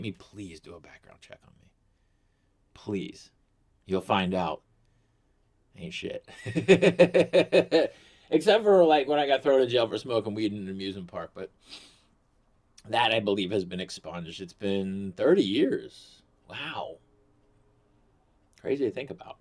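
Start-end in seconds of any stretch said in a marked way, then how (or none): none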